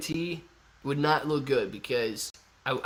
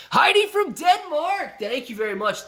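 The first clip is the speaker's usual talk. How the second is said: excited shout-out; 12 semitones higher, 5 dB louder than usual. Real, not acted. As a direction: shout out the donor with big excitement.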